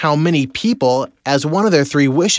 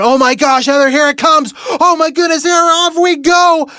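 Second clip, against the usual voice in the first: much higher and louder panicked cries, slowing at the end; freaking out; 13 semitones higher, 4 dB louder than usual. Retold or imitated. Husband rising on the parasail kite beside his wife, panicky yelling. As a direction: let the retold panic climb as you lift off. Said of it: "I'm freaking out".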